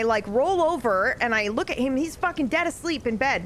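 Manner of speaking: normal talking voice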